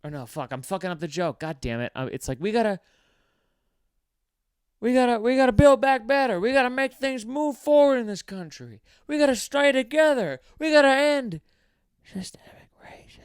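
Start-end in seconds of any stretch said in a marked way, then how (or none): 2.4-11.4 much higher, loud politician rally yell; yelled speech. Retold or imitated. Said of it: "he'll yell in a speech". 12.0-13.2 sudden soft whisper of the key words "systemic racism". Retold or imitated. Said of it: "whispers the most important part".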